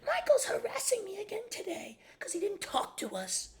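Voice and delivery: very high whining imitation; whiny mock stepmom. Michael mimicking his stepmother's complaint in a high whine. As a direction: whine it like a tattling child. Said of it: "she would consider".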